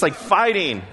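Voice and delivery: usual speaking voice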